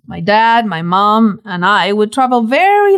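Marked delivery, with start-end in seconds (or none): none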